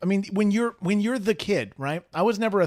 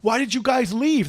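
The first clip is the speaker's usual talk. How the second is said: high, slow aggrieved question; retold protest; 7 semitones higher than usual. Catching up to friends who left him, protesting. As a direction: imitate a wounded demand for an explanation.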